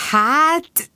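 mock-sweet, grinning hesitation; coy grin. A narrator's reading. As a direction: tease it out with a coy smile. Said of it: "negédesen vigyorgott".

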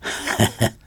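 mocking breathy cackle; vulture guffaw. A narrator's reading. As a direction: let out a scornful bird laugh. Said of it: "röhögött a keselyű". (gúnyos röhögés)

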